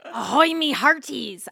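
loud pirate greeting demo. Shown as a demonstration. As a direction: call it out like a pirate.

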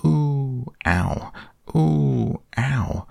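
high ooh, then ow, alternating; jolted cries, oohs pitched high; bounced oohs and ows. A narrator's reading. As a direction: ooh on the way up, ow on the bump. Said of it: "And he was saying".

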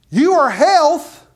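loud slow decree of faith; bold declaration. Acted. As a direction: command the healing in Jesus' name. Preacher commanding healing declaration.

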